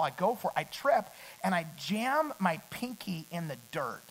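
ordinary delivery

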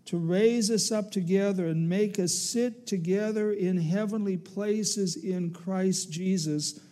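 ordinary talk

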